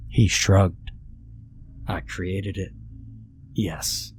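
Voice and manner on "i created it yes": slow and soft; a lightly voiced calm god; quiet calm stranger. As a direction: say it flatly, unbothered. Old man voice.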